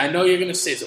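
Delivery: ordinary talk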